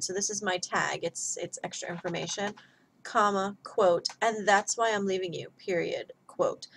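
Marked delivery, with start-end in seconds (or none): none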